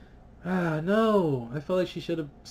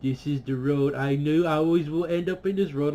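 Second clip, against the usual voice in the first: louder, smug mock Bran voice; 4 dB louder than usual. Fan mocking smug Bran, louder put-on voice. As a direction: sneer it out as a smug know-it-all. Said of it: "smug fucking look where he's just like".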